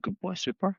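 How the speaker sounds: high, soft praise for a good dog. Retold or imitated. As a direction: gush softly like praising a pet. Praising the dog after muzzle off.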